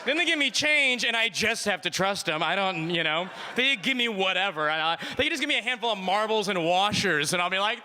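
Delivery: normal talking voice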